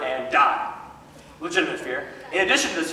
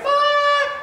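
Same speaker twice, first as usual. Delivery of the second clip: very high, loud cry of Fire!; panicked shout; 4 dB louder than usual. Shown as a demonstration. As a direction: yell Fire! to set off the crowd. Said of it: "they're like".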